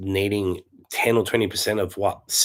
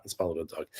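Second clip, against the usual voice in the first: ordinary delivery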